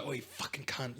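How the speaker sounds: muttered insult to yourself. Retold or imitated. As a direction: curse it under your breath. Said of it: "you'd kinda be like".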